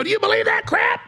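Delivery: high, loud disbelieving yell; mock outraged shout. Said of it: "I go".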